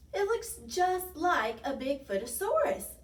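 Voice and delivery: high, amused storybook exclamation. A narrator's reading. Tiny joking with friends about the snowman, amused. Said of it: "laughed Tiny".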